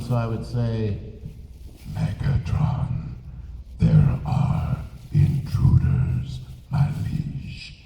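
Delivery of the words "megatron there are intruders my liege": very low, slow, flat-pitched robot drone; robot monotone. Shown as a demonstration. Voice actor demoing his robot henchman reporting to Megatron, slow mechanical monotone. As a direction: speak like a cold machine with no inflection. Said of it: "you talk in a monotone".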